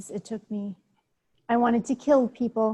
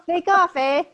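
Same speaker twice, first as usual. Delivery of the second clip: Canadian eh; 6 semitones higher, 4 dB louder than usual. Retold or imitated. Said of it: "And then the eh?".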